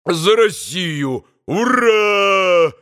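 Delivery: slow toast into a high loud hurrah; proclaiming toast. A narrator's reading. Mitya proclaiming a toast to Russia. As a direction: proclaim it to the room. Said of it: "провозгласил он".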